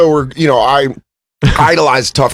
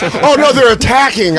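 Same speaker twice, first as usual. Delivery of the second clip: high, hammy panic; mock alarm; 7 semitones higher than usual. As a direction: cry a corny movie warning. Parody of film alarm.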